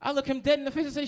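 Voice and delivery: ordinary delivery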